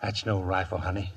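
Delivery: hushed, fond correction; low and soft. Acted. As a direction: murmur it like a secret. Admiring rifle intimately.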